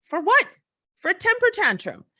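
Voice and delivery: real high-pitched exasperation; outraged disbelief. Real, not acted. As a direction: pitch leaps high in indignation. Outraged rhetorical question and answer.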